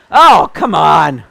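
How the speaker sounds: loud, exasperated yell at the game; frustrated shout. Real, not acted. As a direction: blurt it out in real frustration. Frustrated outburst while gaming.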